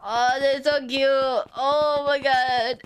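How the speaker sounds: slow, high, gushing coo. Real, not acted. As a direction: melt over something adorable. Gushing over something cute.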